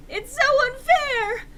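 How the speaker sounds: very loud, high, slow wail; yelled. Acted. Enderby yelling to Draco, loud high whine. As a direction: yell it out like a sulking teen. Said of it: "I yielded".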